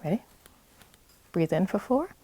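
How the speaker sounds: breathing coach guiding listeners, calm and paced; guided breathing. Shown as a demonstration. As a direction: lead the breath count calmly. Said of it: "breathing exercise".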